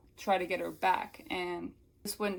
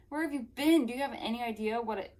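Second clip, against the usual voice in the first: higher, indignant scolding, easing off toward the end; acted screeching; 4 semitones higher than usual. Melanie berating Wanda on her return, higher indignant screech. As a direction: scold in mock outrage. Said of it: "she screeched at me".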